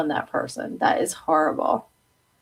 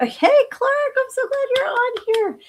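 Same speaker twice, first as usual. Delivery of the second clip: much higher, delighted hello; excited greeting; 4 dB louder than usual. Real, not acted. Greeting a favourite viewer in a much higher, thrilled voice. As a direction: squeal a happy welcome.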